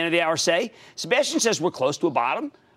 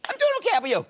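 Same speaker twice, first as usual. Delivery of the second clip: very high, squeaky-pitched reply; exuberant high greeting; much faster than usual. Real, not acted. Playful greeting back to caller.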